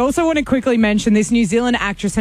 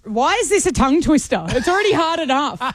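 ordinary talk

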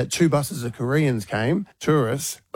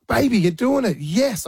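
proud dad cheering; 10 semitones higher than usual. Retold or imitated. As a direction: cheer your daughter on, bursting with pride. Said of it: "I was a proud dad".

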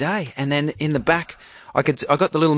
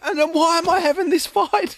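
high-pitched, incredulous lament; exasperated; 13 semitones higher than usual. Retold or imitated. Dad despairs at arguing with his kid, exasperated. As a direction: play up helpless exasperation.

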